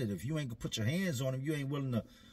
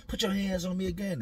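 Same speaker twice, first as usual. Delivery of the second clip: higher, daring challenge; mock tough taunt; 7 semitones higher than usual. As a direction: dare him to try again. D acting tough.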